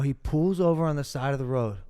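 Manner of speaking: ordinary talk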